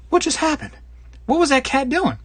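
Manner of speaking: high, bewildered cry on waking; startled and confused. Retold or imitated. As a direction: yelp as if just clawed awake. Woken by cat scratches.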